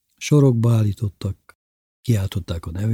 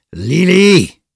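high, very loud bellow of a name; angry yell; 10 semitones higher, 10 dB louder than usual. A narrator's reading. Bubu cuts Lili off, yelling. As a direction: roar the name to stop her. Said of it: "ordította el magát Bubu bácsi".

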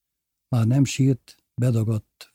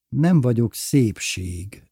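plain reading voice

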